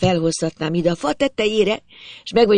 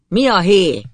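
raised, higher father's call; shouting back; 4 semitones higher, 5 dB louder than usual. A narrator's reading. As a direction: holler back from afar. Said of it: "kiáltotta vissza".

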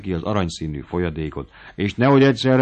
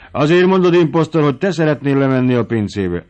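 much higher and louder, calling the servant an impostor; mocking accusation; 6 semitones higher, 7 dB louder than usual. A narrator's reading. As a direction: jab at him, you just want the wine. Squire mocks servant.

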